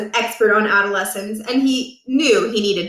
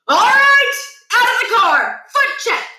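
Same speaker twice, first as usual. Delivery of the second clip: much higher and louder commands; shouted order; 14 semitones higher, 6 dB louder than usual. A narrator's reading. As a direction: bark it like a drill sergeant. Principal barks orders after gagging.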